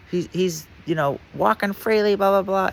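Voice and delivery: high imitated radio panic; mock alarmed report. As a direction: rattle it off high and urgent. Radio voice reports loose inmate.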